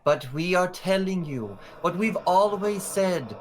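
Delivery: stately official statement with measured pauses; regal orator. Acted. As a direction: address the crowd formally, reassuring them. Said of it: "And she says".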